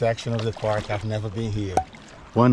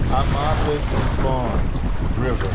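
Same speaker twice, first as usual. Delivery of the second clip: Amable du Fond River slowly, in a French accent, a-MAH-bluh doo FON; slower than usual. Shown as a demonstration. Paddler naming the next river for viewers, in a French accent. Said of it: "Gotta say with the French accent".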